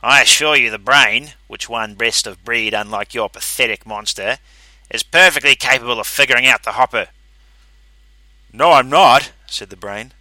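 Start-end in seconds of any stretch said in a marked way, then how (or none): 0.0-7.1 loud, proud insistence; haughty. A narrator's reading. 8.5-9.3 loud mechanical refusal. A narrator's reading. The Brain refuses.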